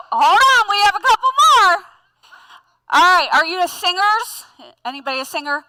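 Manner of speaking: usual speaking voice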